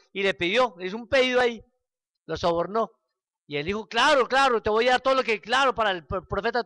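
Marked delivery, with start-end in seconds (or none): 3.9-6.6 eager king agreeing. Retold or imitated.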